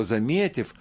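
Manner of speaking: plain reading voice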